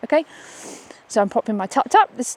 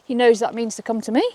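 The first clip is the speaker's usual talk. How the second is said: usual speaking voice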